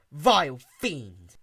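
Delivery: drawn-out, slow menace; slow menacing. Acted. Twilight to Caramel, slow and menacing. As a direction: savour each word slowly.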